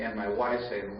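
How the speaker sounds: usual speaking voice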